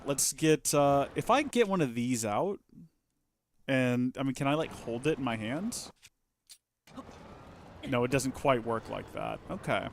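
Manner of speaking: normal talking voice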